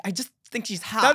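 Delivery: high, loud put-on protest; mock defensive excuse. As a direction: imitate a kid insisting on a cover story. Acting out a closeted kid's excuse.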